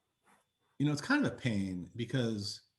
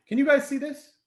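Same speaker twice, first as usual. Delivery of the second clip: calling out to class; 7 dB louder than usual. Real, not acted.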